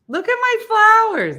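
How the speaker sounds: high, loud squeal; excited showing-off. Real, not acted. Showing off the garden flowers, excited squeal. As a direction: cry it out high and proud.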